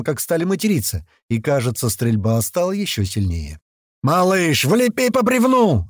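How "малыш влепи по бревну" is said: high, drawn-out holler; yelled order. A narrator's reading. Fighter to Малыш in the gunfight, yelling. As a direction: yell the target across the battle. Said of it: "орёт кто-то ему".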